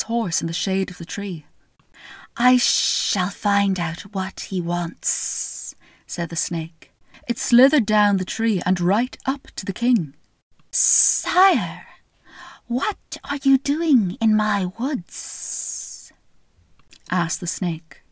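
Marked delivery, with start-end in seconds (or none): 2.4-5.7 slow, sly snake voice; a lightly voiced, unhurried snake. Snake voiced in fairy tale. 10.7-16.1 slow, sly snake voice; a lightly voiced, unhurried snake. Snake voiced in fairy tale.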